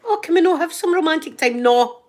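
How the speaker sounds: indignant pleading and refusal. Acted. Lovers falling out over the teeth, indignant. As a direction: play a loud lovers' tiff.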